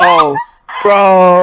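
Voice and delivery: high shrieking laugh into a shout. Real, not acted. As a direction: howl with laughter. Laughing shouts at embarrassing reveal.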